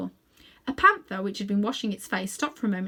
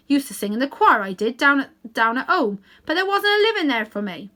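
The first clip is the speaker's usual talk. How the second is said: louder, coaxing Cockney cabbie; 3 semitones higher, 8 dB louder than usual. A narrator's reading. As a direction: coax the old horse like a London cabman. Cabbie reminiscing.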